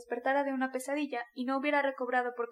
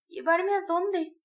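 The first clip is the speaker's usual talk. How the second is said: very slow, higher and hesitant question; timid question; 5 semitones higher, 4 dB louder, much slower than usual. A narrator's reading. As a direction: Sonia asking timidly. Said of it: "preguntó Sonia con timidez". (Sonia pregunta con timidez)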